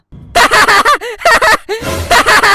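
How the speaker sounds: loud gleeful burst of laughter. Acted. Child laughing loudly at his scolded father. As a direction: cackle out loud at dad's expense.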